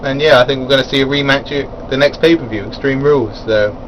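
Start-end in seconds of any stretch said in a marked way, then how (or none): none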